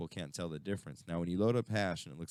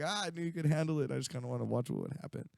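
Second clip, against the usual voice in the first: higher, fast, playful taunt; teasing taunt; 10 semitones higher, faster than usual. Retold or imitated. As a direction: rub it in cheerfully. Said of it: "So I was like".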